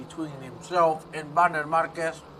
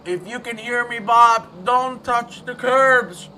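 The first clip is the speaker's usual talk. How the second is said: higher, drawn-out plea to a driver; calling out a warning; 9 semitones higher, 10 dB louder, slower than usual. Real, not acted. Commentator warning Bob off the curbs, pleading call. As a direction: urge him from the commentary box, slowing at the end.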